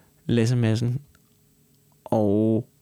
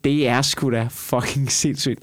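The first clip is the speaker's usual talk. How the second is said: usual speaking voice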